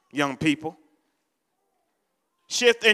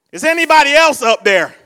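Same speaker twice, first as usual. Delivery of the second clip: loud, raised comic call upward; 4 semitones higher, 8 dB louder than usual. Retold or imitated. Hanging man seeking someone else up there, calling loudly. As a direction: shout past God for another rescuer. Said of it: "And he said".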